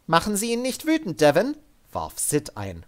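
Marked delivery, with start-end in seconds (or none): 0.1-1.6 higher, firm but controlled order; armored soldier woman. Acted. Sid ordering Devin up at gunpoint, higher and firm. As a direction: keep him steady with a calm threat. Sid's voice.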